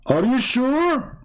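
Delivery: high, long-drawn doubting question; insistent challenge. Retold or imitated. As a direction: stretch it out as a pointed challenge. Repeats the needling question insistently.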